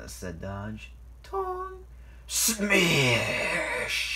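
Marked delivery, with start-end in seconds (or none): 1.2-4.1 high clang, then drawn-out smash; big hissing smash effect; imitated crash. A narrator's reading. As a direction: act out a crash noise. Narrator voices the crash.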